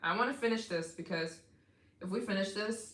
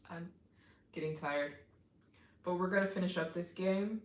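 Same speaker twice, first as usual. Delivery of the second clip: usual speaking voice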